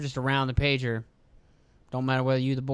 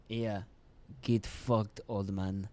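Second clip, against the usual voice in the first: low, soft put-on brush-off; mock Israeli retort, lower and quieter; imagined Israeli voice; 6 semitones lower, 7 dB quieter, slower than usual. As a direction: mutter a curt dismissal in an imitated voice. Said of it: "if that's how they sound".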